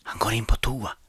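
secret whisper while spying; hush, don't be heard. Pretend. Spying on janitor.

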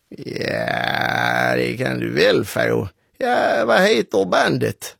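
rough, croaky voice on the phone; hoarse, croaking organiser. Acted. Organiser answering young caller by phone, croaky. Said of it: "skrovlade Bertil i andra änden".

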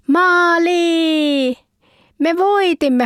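high, jubilant shout of victory; goal cheer. Acted. Finnish player after the winning goal, jubilant high cheer. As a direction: cheer it like you just won the final.

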